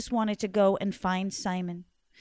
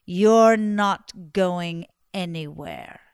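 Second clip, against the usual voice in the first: low, slow, loud witchy threat; menacing old woman; 4 semitones lower, 9 dB louder, much slower than usual. Acted. Old woman forbidding the couple to leave, low and slow. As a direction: drag each word out like a spell.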